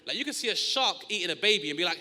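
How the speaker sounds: ordinary talk, nothing marked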